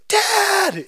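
high shrill scream of Dad. Retold or imitated. Daughter screaming from the bathroom for her dad. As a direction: yell out for dad in panic.

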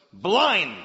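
sudden booming one-word command; shouted command. Acted. Elisha striking the soldiers blind, shouted command. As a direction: shout it like casting a spell.

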